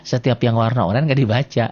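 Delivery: usual speaking voice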